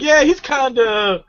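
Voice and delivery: high, drawn-out mock agreement; mimicked reaction. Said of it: "you just kept looking like".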